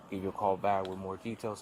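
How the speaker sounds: ordinary talk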